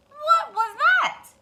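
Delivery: high, loud and slow alarm; startled hen. Acted. Hen startled by the bump on her head. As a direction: peer round in alarm.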